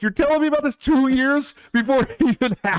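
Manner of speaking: high, disbelieving retold question; incredulous dad. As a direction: play the stunned dad, laughing. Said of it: "I'm like".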